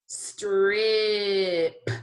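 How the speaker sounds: "Strip" stretched long, final p popped; slow sounding out. Shown as a demonstration. Teacher checking all the sounds, slowly stretched. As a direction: draw out every sound in "Strip". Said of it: "say it slowly again".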